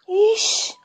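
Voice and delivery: put-on high, chattering young woman's voice; teasing girlfriend. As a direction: tease her friend about the salty meat, pitched high. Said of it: "Bratati বলছে".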